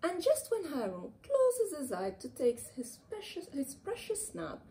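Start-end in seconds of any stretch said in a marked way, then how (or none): none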